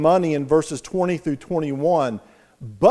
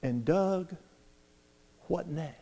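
much softer, almost a whisper of a question; hushed final question; 13 dB quieter than usual. Retold or imitated. Inner voice asking Doug, a hushed final question. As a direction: let it fall away quietly.

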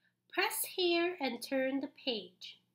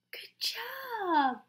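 high, breathy gasp then whispered praise; 8 semitones higher than usual. Acted. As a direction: whisper in wonder.

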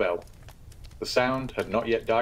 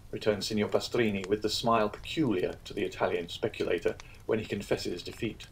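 usual speaking voice

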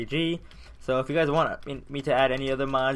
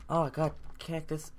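ordinary talk

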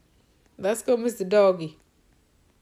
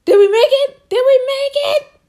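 high, loud breathless question; excited asking; 13 semitones higher, 10 dB louder than usual. Real, not acted. Gamer asking if they finished, high and loud. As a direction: ask it almost shouting with hope.

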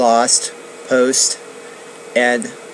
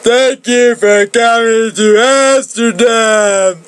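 higher and louder sincere thanks; grateful; 10 semitones higher, 5 dB louder than usual. Acted. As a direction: thank her warmly, lightly voiced. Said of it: "he said".

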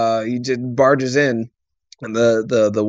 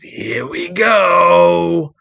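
slow to camera; 8 semitones higher, 6 dB louder, much slower than usual. Retold or imitated. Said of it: "turns to the camera and goes".

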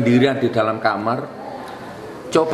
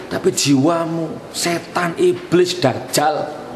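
higher, fierce pulpit denunciation; preaching at full force; 6 semitones higher than usual. Real, not acted. Preacher condemning the five judges, higher and fierce. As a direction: rail at the judges like a fire-and-brimstone preacher.

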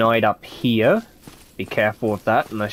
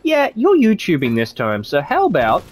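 mocking game voice; 9 semitones higher, 3 dB louder than usual. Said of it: "the game's like".

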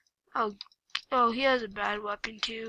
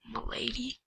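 whispered; 9 dB quieter than usual. Acted. Mock greeting.

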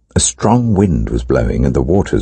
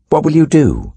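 high demanding crowd; 13 semitones higher than usual. Acted. Crowd demands a sign from Jesus.

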